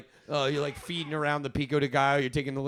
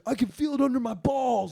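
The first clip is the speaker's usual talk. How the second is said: high, raised retold wail; frantic outburst; 10 semitones higher, 4 dB louder than usual. Answering Fry from the back seat, frantic and high. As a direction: blurt it out in mock distress.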